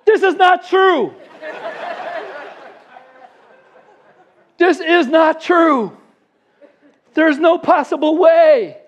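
high, slow, drawn-out exclamation; loud disbelief. Retold or imitated. Teller amid celebrating friends at the impossible hole in one, loud disbelief. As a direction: act out stunned protest, stretching every word.

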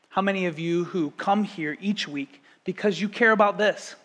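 ordinary talk

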